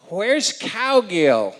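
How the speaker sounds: mock demanding shout, drawn out; loudly asking where he is, in character; imitating Sarah. Retelling how Sarah greeted him, hammed-up shout. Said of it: "she walks up to me and she goes".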